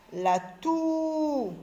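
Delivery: "toux" held long and high, then falling; slow teaching "La toux" with sustained "ou"; elongated vowel. Shown as a demonstration. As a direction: stretch the "ou" in "toux". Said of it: "on allonge un petit peu le son".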